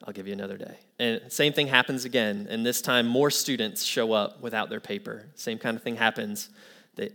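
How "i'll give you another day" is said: quiet, low-key giving in; soft retold concession; relenting professor. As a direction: concede softly, voice dropping away. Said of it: "he kinda relents again".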